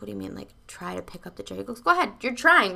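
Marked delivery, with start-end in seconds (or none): 0.0-1.6 quick, soft imitation of a baffled question; puzzled retort. Woman to coach about the chair task, puzzled. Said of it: "she looks at him funny". 1.8-2.7 higher, louder imitation of an insistent coach; insistent prodding. Coach urging woman to lift the chair, insistent.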